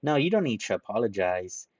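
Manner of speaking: usual speaking voice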